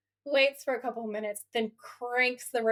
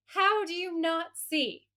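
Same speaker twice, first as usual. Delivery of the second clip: higher, slow, disbelieving question; exasperated; 7 semitones higher, slower than usual. Retold or imitated. Viewer yelling at clueless horror characters, exasperated. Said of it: "I was like".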